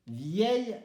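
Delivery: emphatic, carefully shown "Vieille"; careful pronunciation. Shown as a demonstration. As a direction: model "Vieille" carefully, final L sound absent. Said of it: "attention, difficile".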